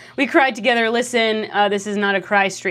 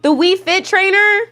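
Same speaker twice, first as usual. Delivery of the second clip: high, loud excited gush; excited squeal; 9 semitones higher, 5 dB louder, slower than usual. Real, not acted. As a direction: gush over her, pitched way up. Excited about Wii Fit trainer.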